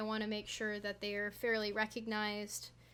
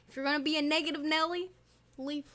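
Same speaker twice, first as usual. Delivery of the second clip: sassy; 4 semitones higher, 5 dB louder, faster than usual. Real, not acted. Telling off negative viewers.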